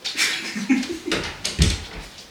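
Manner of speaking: laughing. Real, not acted. Husband laughs at the prank.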